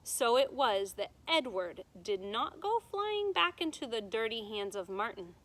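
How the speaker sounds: usual speaking voice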